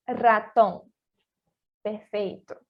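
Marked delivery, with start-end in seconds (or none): 0.1-0.9 "Raton" with a trilled first R; repeated model of "Raton"; rolled initial R. Shown as a demonstration. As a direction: roll the R at the start of "Raton". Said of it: "R inicial também vai ser o vibrante".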